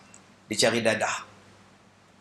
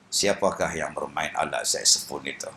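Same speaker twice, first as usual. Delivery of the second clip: put-on blind old Kasim; 11 semitones lower, 4 dB quieter than usual. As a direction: drop low to play blind Kasim. Blind Kasim asks about saxophone. (suara Kasim yang buta, rendah)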